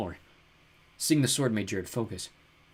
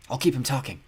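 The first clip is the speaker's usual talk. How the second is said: usual speaking voice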